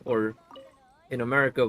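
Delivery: ordinary talk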